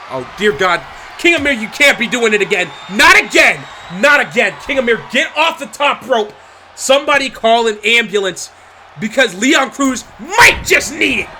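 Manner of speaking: loud, pleading, alarmed cries; horrified shout. Real, not acted. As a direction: beg him to stop in horror. Horrified live commentary.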